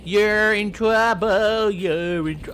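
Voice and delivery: slow, loud scolding mom voice; mock mother telling off her kid; mommy scolding. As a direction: drag it out like a mad mom. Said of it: "Mommy's gonna be like".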